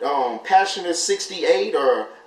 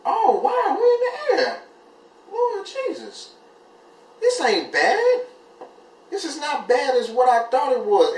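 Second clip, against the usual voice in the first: giddy amazement; 11 semitones higher than usual. Retold or imitated. A relieved first-time flyer looking down from the plane, high giddy laughing awe. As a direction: squeal with relieved delight.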